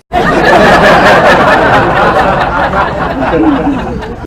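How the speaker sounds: big real laugh at his own joke; helpless laughter after the punchline; loud laugh. Real, not acted. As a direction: burst out laughing, loud and high.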